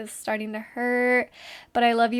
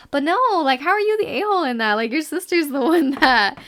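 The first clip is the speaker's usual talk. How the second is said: louder and higher in disbelief; incredulous outburst; 5 semitones higher, 6 dB louder than usual. Real, not acted. Host reacting to the listener's story, loud and incredulous. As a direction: burst out that it makes no sense. Outraged reaction to sister's post.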